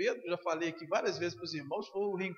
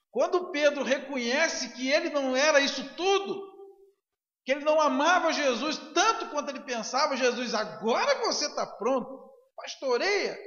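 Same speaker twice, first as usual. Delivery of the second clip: higher, louder fervent preaching; preaching at full force; 6 semitones higher, 7 dB louder than usual. Acted. Pastor preaching to the church, fervent and loud. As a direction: drive the point home to the congregation.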